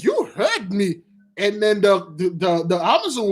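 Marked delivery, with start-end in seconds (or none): 0.0-1.0 smug mock reply. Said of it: "she said".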